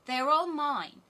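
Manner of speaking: high, loud robber maiden; loud, possessive child's boast; bold robber girl. A narrator's reading. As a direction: claim them loudly as a wild little girl. Robber girl boasting of her pigeons.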